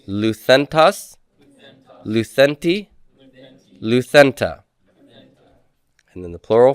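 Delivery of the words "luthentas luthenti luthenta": slow, deliberate chanting of the paradigm; teacher chanting endings for students to repeat; drill recitation. Shown as a demonstration. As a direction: lead the class through the forms aloud. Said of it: "So everybody".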